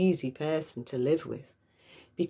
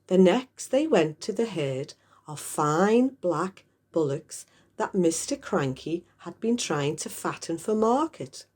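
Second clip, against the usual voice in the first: ordinary delivery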